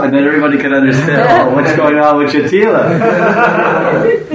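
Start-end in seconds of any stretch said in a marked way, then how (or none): 1.3-4.3 slow, higher, laughing mock gossip. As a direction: chuckle through the gossip. Onlookers laughing at Jatila.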